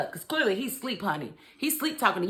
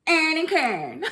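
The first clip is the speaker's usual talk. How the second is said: sing-song name; 8 semitones higher, slower than usual. Shown as a demonstration. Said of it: "I don't know why I'm like that".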